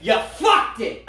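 loud, slow angry yell through laughter; retold yell. Wrestler yelling at trainees after a botched finish, loud mock fury. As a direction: yell it at them like he blew the finish.

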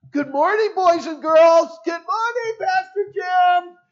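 put-on high, loud and slow, calling out to a room of kids; loud sing-song greeting. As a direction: greet the children like a cheerful pastor. Kids' chapel greeting, called out.

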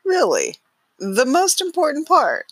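high, loud incredulous question; a mother teasing her son; mock disbelief. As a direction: tease him with exaggerated surprise. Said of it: "I was like".

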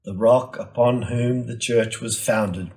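ordinary talk, nothing marked

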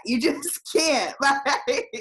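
can't hold back the giggle; breaking into real laughter mid-sentence. Real, not acted. Laughs at her own example.

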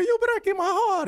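high-pitched mock Italian accent; Jared Leto's Italian accent. Mocking an actor's Italian movie line, high mock Italian accent. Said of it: "every time I heard him talking".

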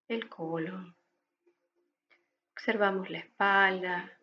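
calm breathing guide, unhurried and gentle; guided meditation. Acted. (voz de meditación guiada)

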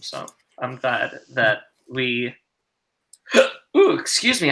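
hiccup cutting off the words; sudden squeaky hiccup mid-sentence. Real, not acted. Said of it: "I have the hiccups now".

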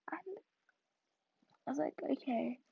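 talking through a yawn; words stretched by a yawn. Real, not acted. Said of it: "Sorry, that was a yawn".

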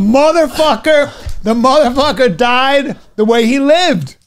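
slow, loud and high, savoring every word; awed exclamation. Real, not acted. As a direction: land it like the punchline you can't believe. Awed punchline of mob story.

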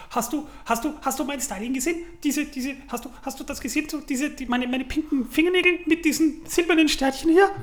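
very high, fast cartoon baby voice; squeaky, pestering cartoon kid; Stewie from Family Guy. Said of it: "du klingst so ein bisschen wie Stewie aus Family Guy".

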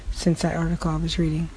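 ordinary delivery

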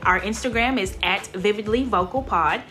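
loud, steady promo read. Acted. Host reading the promo to listeners, in an ad-read voice. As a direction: pitch the podcast like an announcer.

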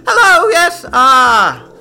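very high, loud, halting mad wizard; Franklin, mad delight. Acted. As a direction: greet a visitor like a delighted lunatic.

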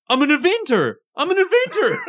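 high-pitched, exclaimed movie-line impression; Mark Wahlberg impression. Co-host mocks a Transformers line, high-pitched actor impression. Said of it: "what about Transformers".

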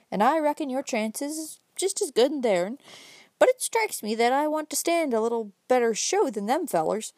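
a lightly voiced old country man, rising as he goes; folksy old fellow building up his point, slowing at the end; old Uncle Bobby. Old man lecturing the young man about smoking, lightly voiced and folksy.

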